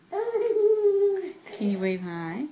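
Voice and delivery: very slow, drawn-out sing-song; slow baby talk. Shown as a demonstration. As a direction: stretch each word for the baby. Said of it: "Say hi".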